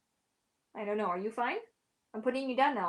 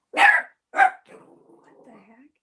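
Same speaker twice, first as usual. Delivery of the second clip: startled whisper; 13 dB louder, much slower than usual. Real, not acted. Startled by the dog.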